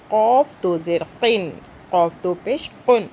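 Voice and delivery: قاف and قن with a heavy Q from far back; thick qaf. Shown as a demonstration. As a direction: lift the back of the tongue to touch for قاف. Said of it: "وہ موٹا ہے". (قاف موٹا، حلق سے)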